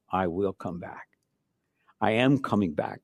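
hushed. Acted. Prayerful repeat.

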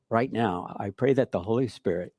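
normal talking voice

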